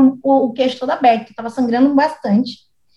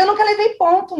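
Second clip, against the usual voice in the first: high nervous plea; 7 semitones higher than usual. Retold or imitated. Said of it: "Eu falei".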